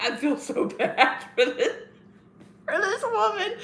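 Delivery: words breaking up with laughter; amused, helpless laughing pity. Real, not acted. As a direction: try to get it out through real giggles. Cracks up retelling the booing.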